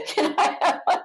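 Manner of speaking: breaking into laughter while quoting; laughing. Retold or imitated. Retelling women eagerly asking, laughing.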